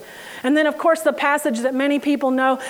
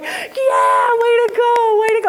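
excited proud parent yelling way to go; cheering parent; 8 semitones higher than usual. Retold or imitated.

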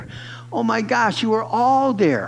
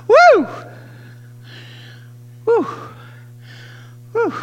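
joyful whoop; 6 dB louder than usual. Real, not acted. Preacher whooping at the climax.

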